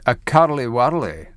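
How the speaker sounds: Edinburgh Scottish accent on curly whirly; deep Scottish accent. Shown as a demonstration. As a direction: say it like an Edinburgh local. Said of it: "with a, a deep Scottish accent".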